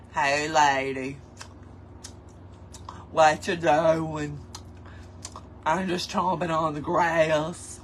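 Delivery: low, slow deer voice. Pretend. Crafter voicing a deer in the backyard, low and slow. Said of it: "they probably go".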